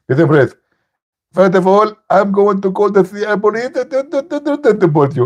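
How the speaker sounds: mock the opponent in his voice; imitating Zakir Naik. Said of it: "Zakir Naik".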